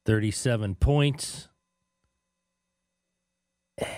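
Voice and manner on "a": hushed whisper of a grade; tiny, secretive whisper. Real, not acted. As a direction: whisper it barely audibly. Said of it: "What are you whispering for?".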